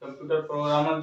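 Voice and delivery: ordinary delivery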